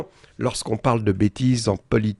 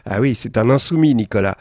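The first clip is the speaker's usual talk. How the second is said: normal talking voice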